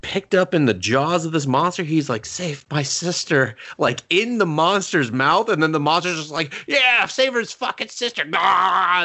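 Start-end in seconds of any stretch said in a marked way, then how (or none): 2.2-3.6 slow, strained mock plea; pleading little brother. Dying little brother's plea, retold. 6.7-9.1 high, hammed-up monster jeer ending in a roar; mocking monster. Monster mocks the boy, retold.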